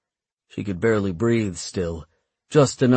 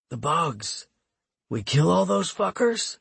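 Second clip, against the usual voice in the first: hoarse, breathy rasp; smoker's rasp; 8 semitones higher, 3 dB quieter than usual. Acted. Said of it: "sounded like a three packs a day smoker".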